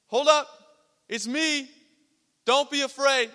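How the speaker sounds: lightly acted shout to calm them; acted calling out. Jesus to frightened disciples, calling out.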